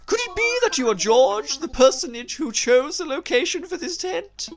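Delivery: high, loud, hooting academic; effusive scholarly owl. Acted. Owl researcher greets the tent's founder, high and effusive. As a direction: play a pompous, delighted owl professor. Voices Blathers introducing himself.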